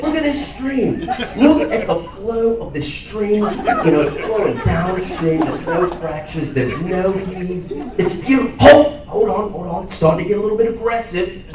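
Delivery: put-on Australian accent, thrilled, laughing, pitched high; Steve Irwin impression. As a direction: wonder at a stream like a crocodile hunter. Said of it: "now's a good time that I could do my impersonation".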